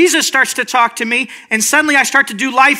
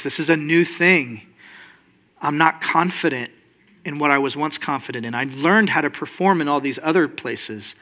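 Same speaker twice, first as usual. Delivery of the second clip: lower, softer, halting confession; insecure prayer; 8 semitones lower, 6 dB quieter than usual. Acted. Son confessing to Papa that he is lost, insecure and low. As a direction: pray it low and unsure, stumbling at the start.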